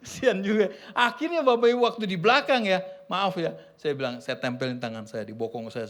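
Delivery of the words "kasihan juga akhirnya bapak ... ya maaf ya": ordinary talk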